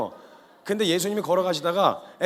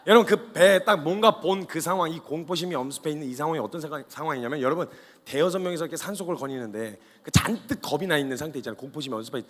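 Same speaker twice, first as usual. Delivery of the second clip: ordinary delivery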